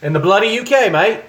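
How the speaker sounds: loud, high mock Australian accent. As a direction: greet him like a cheery Aussie bloke. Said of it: "Okay, that was Australian".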